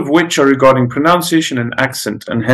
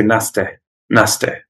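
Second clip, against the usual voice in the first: Yorkshire "naste", final vowel opened to "eh"; Yorkshire accent. Shown as a demonstration. Teacher showing how Yorkshire says nasty, Yorkshire accent. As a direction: end "naste" on "eh" rather than "ee", Yorkshire style. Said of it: "in Yorkshire".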